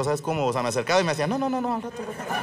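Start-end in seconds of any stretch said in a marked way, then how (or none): 1.3-2.3 high, rapid-fire mock refusal; dismissive brush-off. Girl shooing him off like a windshield washer, dismissive. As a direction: wave off a windshield washer, voice pitched up. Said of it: "Me sentía yo como limpiavidrios de crucero".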